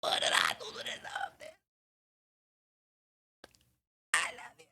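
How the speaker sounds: hushed whispered plea, very soft; intimate, barely voiced hurt whisper; good acting. Shown as a demonstration. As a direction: whisper it as if truly in the feud. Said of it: "here's the good acting".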